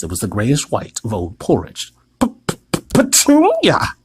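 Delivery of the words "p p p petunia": stammering, then a much higher cry; panicked gasping for his wife; gasped stammer. A narrator's reading. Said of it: "he gasped".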